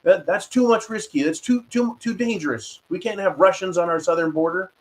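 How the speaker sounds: high-pitched, mock-panicked protest; alarmed Americans. Host voicing Americans upset about Russians on the border, mock alarm. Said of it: "many Americans to be like".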